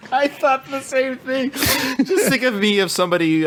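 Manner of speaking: quoting the line through laughter; can't get the words out for laughing; giggling through a movie quote. Real, not acted.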